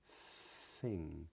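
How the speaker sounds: "thing" with a soft TH of pure air, no voice; voiceless TH. Shown as a demonstration. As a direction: let "thing" start with just breath. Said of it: "it's just air".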